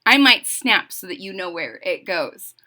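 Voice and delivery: ordinary delivery, nothing marked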